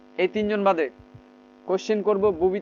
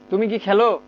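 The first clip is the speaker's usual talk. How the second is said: weight landing on খেলো at the end; stress on খেলো. Shown as a demonstration. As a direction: hit খেলো as you ask. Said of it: "খেলো শব্দটার উপরে জোর দিতে হবে".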